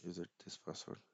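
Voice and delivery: normal talking voice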